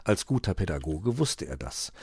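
plain reading voice

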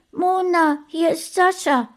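hohe Kinderstimme am Telefon. A narrator's reading. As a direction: meld dich als kleiner Junge. Kind ruft an. (hohe Kinderstimme)

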